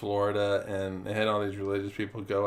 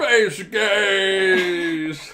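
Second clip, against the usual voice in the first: booming, higher, drawn-out movie-trailer shout; 1950s sci-fi announcer; 9 semitones higher, 9 dB louder than usual. Acted. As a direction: announce it like an old B-movie title. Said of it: "say it like a nineteen fifties, like, uh, you know, sci-fi film".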